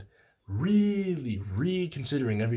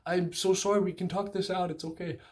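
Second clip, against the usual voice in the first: higher pleading mock plea; imitating my desperate texts, pitched up; 8 semitones higher than usual. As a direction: beg her, higher and urgent. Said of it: "kind of beg".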